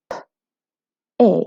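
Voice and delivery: "p, a" segmented for learners, each sound alone; sounding out phonemes. Shown as a demonstration. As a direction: say "p, a" as two separate sounds with a pause between. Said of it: "The sounds are".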